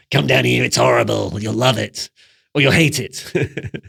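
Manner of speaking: hammed-up luring troll voice, slowing at the end. Troll calling the viewer under the bridge, hammed-up troll voice. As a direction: tempt them down like a gleeful bridge troll.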